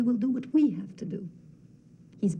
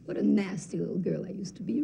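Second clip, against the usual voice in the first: normal talking voice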